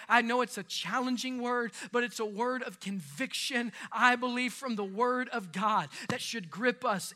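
ordinary talk

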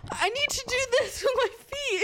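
very high panicked plea, breaking into laughter; mock panic. Patient retelling her plea to her partner, mock panic. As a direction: replay your own frantic plea, giggling. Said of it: "and I'm like".

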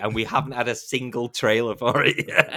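imitating indignant moviegoers, laughing through it; mock outrage. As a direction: play the exasperated crowd of fans, pitch up. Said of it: "everyone's like".

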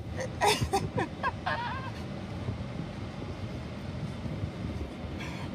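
soundless wheezing laugh close to tears; long wheezing laugh; helpless laughter. Real, not acted. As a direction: laugh till you can't breathe. Laughing after the interview.

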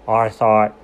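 normal talking voice

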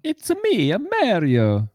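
very high cartoon plumber voice; high-pitched catchphrase, dropping at the end; Mario impression. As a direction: squeak it out like the game mascot. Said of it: "So when you say, "It's a me".

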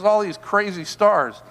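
ordinary delivery

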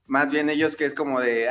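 ordinary talk

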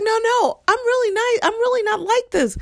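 very high, insistent pleading; pleading student. Retold or imitated. Student insisting to the librarians she is not like this. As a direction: squeal that you are really nice. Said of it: "she's like".

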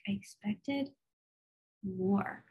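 a quiet let-down aside to the Lord. Retold or imitated. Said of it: "I was like".

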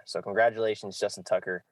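ordinary talk, nothing marked

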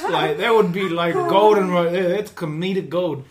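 ordinary delivery

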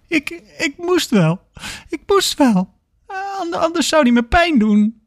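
very high, stammering fearful voice; acted terror, tripping over words; panicked. A narrator's reading. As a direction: plead in a squeaky, frightened pitch. Panicked patient justifies himself.

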